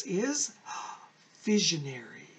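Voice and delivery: gasp, then unveil the word; a breathless little reveal; dramatic gasp reveal. Acted.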